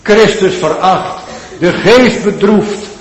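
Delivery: loud, high pleading sermon voice; fervent preaching. Acted. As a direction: cry it out as a desperate sinner. Preaching at full force. (luid en smekend gepreekt)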